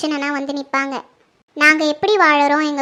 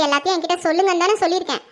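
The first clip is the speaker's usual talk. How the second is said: normal talking voice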